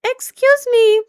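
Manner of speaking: very high, drawn-out little voice; high squeaky apology. Real, not acted. Streamer excusing a sudden noise, high squeaky voice. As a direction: pitch it way up and stretch it out.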